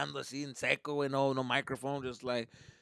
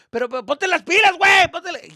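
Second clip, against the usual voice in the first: much higher and louder scolding; dad shouting; 15 dB louder than usual. Retold or imitated. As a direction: yell at him to hurry. Dad yelling at son.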